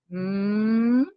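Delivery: "mm" hummed long and soft; held m sound. Shown as a demonstration. Teacher modelling the first sound of ma. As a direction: hum "mm" with lips closed and let it ring.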